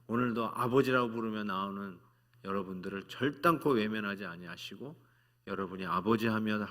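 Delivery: ordinary delivery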